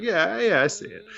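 high, quick casual yeah yeah; mock Bill Burr. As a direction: toss off a breezy agreement. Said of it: "And Bill Burr's like".

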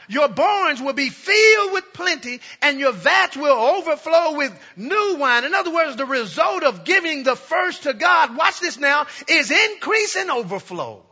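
loud, high, rising preaching; preaching at full force. Acted. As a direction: build the promise to a shout.

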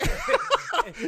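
loud, high-pitched cackle; real explosive laugh at own punchline; laugh burst. Real, not acted. Cracks up at own story.

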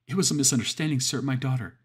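high, loud frightened pleading; panicked pleading. Acted. Father panics before Satan over his deal. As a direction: beg the devil in a panic.

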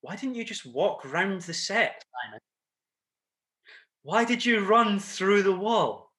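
stage manager quizzing the actor after the show, mock bewildered; imitating the stage manager. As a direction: play the baffled stage manager asking why. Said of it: "she said to me after the show".

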